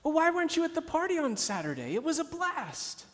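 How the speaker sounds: high, quick schoolgirl chatter; chirpy first grade girl. Retold or imitated. Classmate tells the girl about the party she missed, high chirpy voice. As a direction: pitch it up like a bubbly little girl. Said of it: "and you hear".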